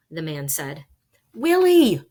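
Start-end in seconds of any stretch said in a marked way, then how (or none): 1.3-2.0 full shout of "Willie!", high and loud; frightened boy screaming a ghost's name. Acted. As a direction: call for help at the top of your voice. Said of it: "I shouted".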